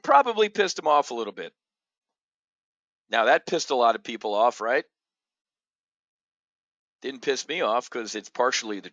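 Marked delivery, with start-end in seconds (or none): none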